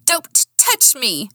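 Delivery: high, drawn-out protest; angry yell. A narrator's reading. Woman yanking out of his grip, yelling. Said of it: "I yelled".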